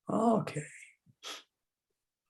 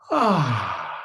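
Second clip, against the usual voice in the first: big sigh; 4 semitones lower, 4 dB louder than usual. Shown as a demonstration. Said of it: "a nice big sigh".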